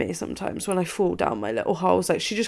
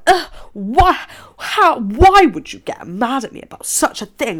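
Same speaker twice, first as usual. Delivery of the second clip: higher, louder, exasperated complaint; mock exasperation; 7 semitones higher, 7 dB louder than usual. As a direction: throw up your hands in mock outrage. Retelling her own annoyed reply.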